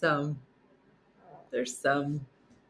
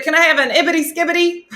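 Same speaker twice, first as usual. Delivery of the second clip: high, loud, fast, cocky order; high confident old man ordering nonsense; confident grandpa order; 12 semitones higher, 8 dB louder, faster than usual. Retold or imitated. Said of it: "with such confidence".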